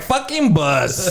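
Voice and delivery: slow, drawn-out grumble at Buzz; exasperated. Retold or imitated. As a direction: stretch it out, fed up but amused. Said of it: "I was like".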